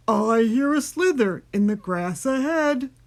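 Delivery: loud storybook monster voice; Gruffalo voice. A narrator's reading. Gruffalo hears something ahead, loud monster voice. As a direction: announce it as a big beast. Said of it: "when the Gruffalo said".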